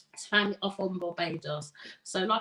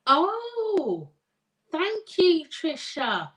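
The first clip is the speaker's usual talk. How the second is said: high, swooping, delighted surprise; pleasantly surprised; 6 semitones higher, 5 dB louder, much slower than usual. Real, not acted. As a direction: react with real surprise, pitch leaping up. Reacts to viewer's surprising message.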